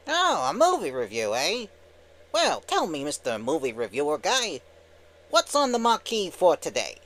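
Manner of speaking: brash cartoon wise guy; Timon. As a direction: quiz the reviewer like a cheeky cartoon sidekick. Skit voicing Timon.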